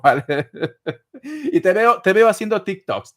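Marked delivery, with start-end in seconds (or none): 1.5-3.1 louder, laughing as he jokes. Real, not acted. Reader joking to Derlis about making TikToks, laughing. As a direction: chuckle through the picture you paint.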